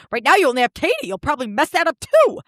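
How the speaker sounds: put-on voice, rising on the jab; Ramona. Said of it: "In the immortal words of Ramona".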